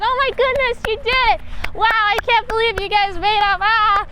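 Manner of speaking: gushing high onlooker voice. Retold or imitated. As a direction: squeal like thrilled friends. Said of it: "people are like".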